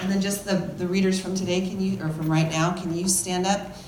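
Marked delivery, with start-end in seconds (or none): none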